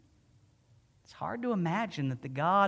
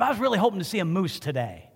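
eager hope; 5 semitones higher, 6 dB louder, faster than usual. Retold or imitated. Telling the stranger his hope.

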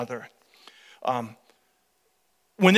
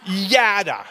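ordinary talk, nothing marked

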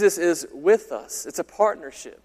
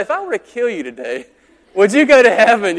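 louder, higher mock menace; threatening doorstep pitch; 4 semitones higher, 8 dB louder than usual. Pastor mimics a door-knocker threatening a stranger, loud mock menace. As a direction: lean in like a creepy stranger at the door. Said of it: "how threatening does that sound".

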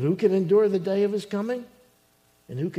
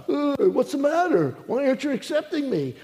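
high, loud mock complaint; crying out; 9 semitones higher, 4 dB louder than usual. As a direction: cry out in imitated indignant protest. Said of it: "they're crying out".

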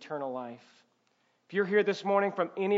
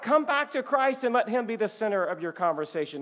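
ordinary talk

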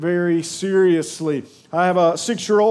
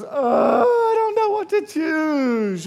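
put-on much higher, slow, helpless child voice; little girl; 7 semitones higher, slower than usual. Dad imitating his daughter stuck at the closet, high and helpless. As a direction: draw out the dithering child. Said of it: "And so she goes".

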